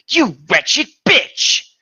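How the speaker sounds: slow, high, loud villain shriek; villain snarl. Acted. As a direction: spit the insult out like a cartoon villain. Villain insult read from fanfic.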